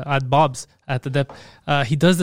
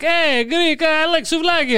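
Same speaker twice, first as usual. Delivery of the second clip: high, over-friendly mock salesman greeting; pandering car salesman; 11 semitones higher, slower than usual. Car guy greeting a Greek customer, mock pandering. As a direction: pitch it way up, mock chumminess. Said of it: "watching a car guy, depending if there's a Greek guy coming".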